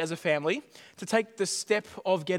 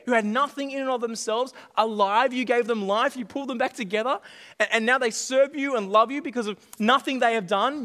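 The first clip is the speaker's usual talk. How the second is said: normal talking voice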